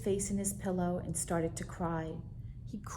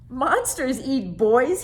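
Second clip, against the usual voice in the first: higher, louder, slow giggling cry; laughing disbelief; 6 semitones higher, 11 dB louder than usual. Acted. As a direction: crack up at the absurd idea. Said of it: "he cried".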